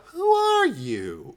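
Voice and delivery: very high, loud, stretched-out question; cheery imitation. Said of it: "I like her cheeriness".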